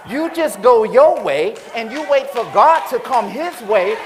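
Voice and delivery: loud, high preaching shout; preaching at full force. Acted. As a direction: bring the church to its feet. Preaching climax.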